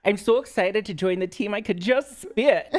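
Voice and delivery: high, loud Minnesota accent; hammed-up Minnesota accent, laughing at the end. Shown as a demonstration. New team member obliges co-host's request, in a playful Minnesota accent. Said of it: "say that in your Minnesota accent".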